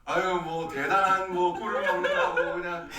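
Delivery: sarcastic. Retold or imitated. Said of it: "Being very sarcastic".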